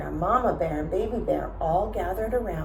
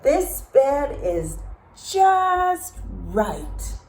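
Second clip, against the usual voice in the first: content Goldilocks; 8 semitones higher, 3 dB louder, much slower than usual. A narrator's reading. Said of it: "she murmured".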